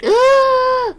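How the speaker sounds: loud, high gasping shriek; startled gasp. Real, not acted. Streamer spotting a surprise raid, startled shriek.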